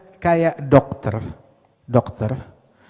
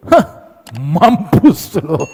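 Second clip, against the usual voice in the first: slow, loud sneering jeer; mocking doctor; 7 dB louder, much slower than usual. Mean doctor jeering at dying patient, slow and loud.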